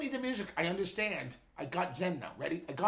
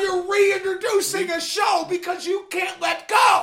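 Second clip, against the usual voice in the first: slow, much higher and louder shout breaking into laughter; laughing shout; 9 semitones higher, 6 dB louder, slower than usual. Real, not acted. [name] laugh-yelling at his co-host to let go. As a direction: yell it while cracking up. Heated podcast argument.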